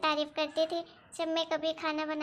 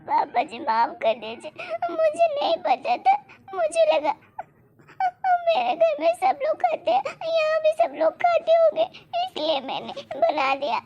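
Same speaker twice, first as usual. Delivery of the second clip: high, loud wailing apology; tearful pleading; 12 semitones higher, 5 dB louder than usual. Acted. Daughter-in-law begging father-in-law's forgiveness, crying. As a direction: plead through tears.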